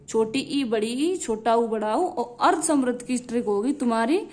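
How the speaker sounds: ordinary talk, nothing marked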